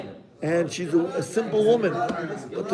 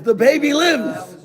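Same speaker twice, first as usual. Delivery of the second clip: higher, louder burst of joy; joyful news; 7 semitones higher, 5 dB louder than usual. Acted. Maria telling the priest her baby lives, joyful. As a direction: announce the miracle with delight.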